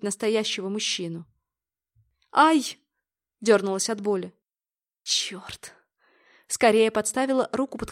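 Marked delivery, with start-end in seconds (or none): 2.3-2.8 резкий высокий вскрик от ожога; вскрикнуть от внезапной боли. A narrator's reading. Вскрик от боли при ожоге. 5.0-5.7 ругнуться шёпотом сквозь зубы; тихо выругаться себе под нос. A narrator's reading. Шёпотом ругается после ожога.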